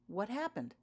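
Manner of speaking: usual speaking voice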